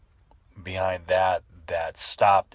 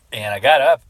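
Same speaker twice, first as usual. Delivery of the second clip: ordinary talk, nothing marked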